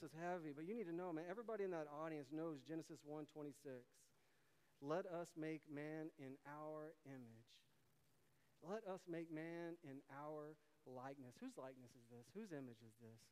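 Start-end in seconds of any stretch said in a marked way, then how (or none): 4.8-11.4 solemn scripture reading. Real, not acted. Quoting Genesis solemnly.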